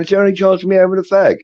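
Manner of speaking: high, fast, pleading prisoner's voice; cheeky plea. Retold or imitated. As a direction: beg for a smoke in a quick, high whine. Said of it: "They're going".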